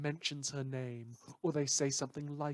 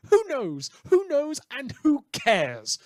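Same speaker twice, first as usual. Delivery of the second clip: much higher and louder, gleeful; manic glee; 11 semitones higher, 11 dB louder than usual. Acted. Radio host to listeners about the ended world, manic glee. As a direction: throw it away with reckless delight.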